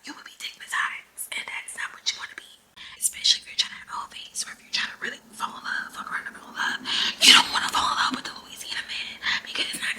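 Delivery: hushed secret whisper. Real, not acted. Vlogger whispering a secret about Louisiana men to viewers. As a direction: lean in and whisper the juicy secret. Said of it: "I don't even know why I'm whispering".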